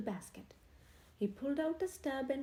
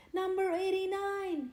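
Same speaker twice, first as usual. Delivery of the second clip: higher, louder shout; 8 semitones higher, 6 dB louder than usual. A narrator's reading. Henry charges through the crowd, shouting. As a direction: yell across the crowd. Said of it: "he shouted".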